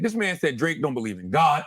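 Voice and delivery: ordinary talk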